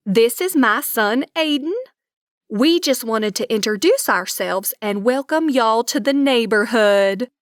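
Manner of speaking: lightly voiced thick Southern accent; friendly Texas Southern lilt, lightly done. Neighbor introducing herself, light Southern accent. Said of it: "with a very thick Southern accent".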